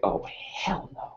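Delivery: breathy hushed oh hell no; whispered dismay. Retold or imitated. Student whispering dismay at a Friday night review. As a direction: whisper it in slow disbelief.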